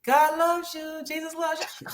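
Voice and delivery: put-on high, bright child's voice, calling out to strangers; imitating eager child. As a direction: mimic a little boy cheerfully greeting passersby. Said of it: "you were like".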